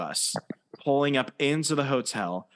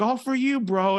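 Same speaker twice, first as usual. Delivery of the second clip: high bro voice; 9 semitones higher than usual. Retold or imitated. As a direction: play the frat bro. Quoting the frat bro.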